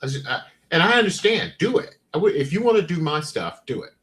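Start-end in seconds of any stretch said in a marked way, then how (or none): none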